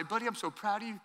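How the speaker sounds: tender dad. Retold or imitated. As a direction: say it softly like holding your son's face. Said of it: "I'll say".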